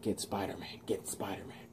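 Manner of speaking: very soft, voiceless urging; hushed repeated whisper; acted whisper. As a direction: whisper it secretively. Voices whispers around Max.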